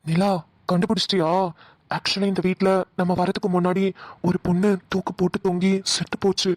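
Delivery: husky spooky voice. Acted. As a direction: tell it like a low creepy ghost tale. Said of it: "நீ பேசுற husky voice தாயா பயமா இருக்கு". (கிசுகிசுப்பான பேய்க் கதைக் குரல்)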